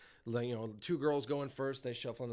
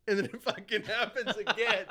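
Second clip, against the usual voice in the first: laughing; 8 semitones higher, 4 dB louder than usual. Real, not acted. Laughing while telling.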